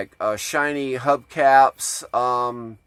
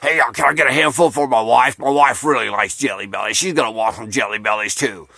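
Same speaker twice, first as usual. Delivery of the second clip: Papa Jimmy impression; 2 semitones lower than usual. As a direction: imitate a drunken moocher asking for seconds. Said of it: "try to do an imitation of Jimmy's voice".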